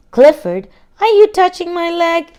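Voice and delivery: high, loud alarmed question; startled alarm. Acted. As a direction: call out to Clifford in alarm. Emily startled asks Clifford.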